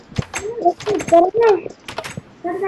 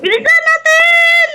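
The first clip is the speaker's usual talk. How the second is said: slow, high drawn-out yell; excited shout; 11 semitones higher, 5 dB louder, slower than usual. Pretend. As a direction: stretch out the call.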